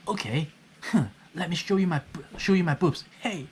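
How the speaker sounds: imitating an indignant reply; mock indignant retort. Acts out someone taking the bait.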